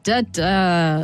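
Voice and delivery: "Da, da" with the pitch stepping down on the second "da"; falling tune. Retold or imitated. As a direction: drop the voice on the last "da" like a newsreader. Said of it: "they, like, go down at the end of their sentences".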